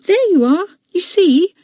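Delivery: put-on high, triumphant little-boy voice; triumphant small boy. Leslie to Robert, high triumphant boy voice. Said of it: "said Leslie".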